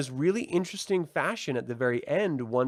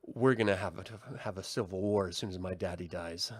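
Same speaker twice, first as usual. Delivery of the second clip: grim realization; 7 semitones lower, 7 dB quieter than usual. Retold or imitated. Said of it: "Princess Rhaenyra realizes".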